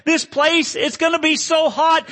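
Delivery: high, loud, desperate plea; begging. Acted. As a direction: beg at the top of the voice. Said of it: "I begged".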